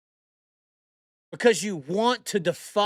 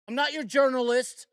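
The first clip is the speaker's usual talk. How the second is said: ordinary delivery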